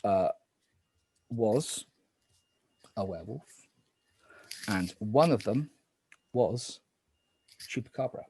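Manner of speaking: low, hushed night-phase calls; spooky game narrator. Acted.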